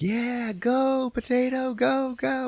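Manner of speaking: high little cheer; tiny veggies cheering the potato on; squeaky cheering veggies. Retold or imitated. Vegetables cheering.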